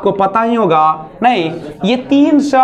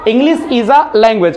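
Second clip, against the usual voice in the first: slow model sentence; much slower than usual. Shown as a demonstration. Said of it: "teacher models sentence".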